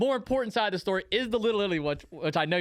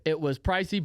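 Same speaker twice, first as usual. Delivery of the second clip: normal talking voice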